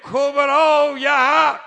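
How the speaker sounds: preaching at full force. Acted. Preacher chanting Ali's titles to the crowd, slow and loud. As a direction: proclaim each title of Ali like a rally cry.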